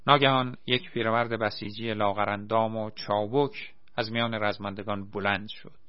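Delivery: usual speaking voice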